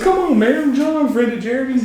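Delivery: high, eager mock voice; imitating the guest. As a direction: pitch it up like a nervous party guest. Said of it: "He goes".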